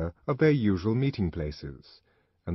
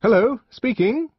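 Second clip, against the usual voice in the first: phone greeting; 9 dB louder than usual. Acted. Answering telephone.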